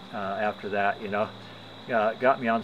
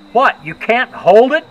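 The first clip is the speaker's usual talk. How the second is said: loud, high, drawn-out scolding question; mad nurse; 11 semitones higher, 12 dB louder, slower than usual. Retold or imitated. Mad nurse scolding patient over the bed, loud indignation. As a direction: imitate an exasperated nurse, voice leaping up. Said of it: "she just got mad".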